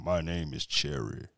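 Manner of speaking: very low, soft, slow introduction; deep-voiced man. Mocking a deep-voiced man named Cherry, low soft imitation. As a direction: drop the pitch right down for a mock sweet name. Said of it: "three octaves lower than mine".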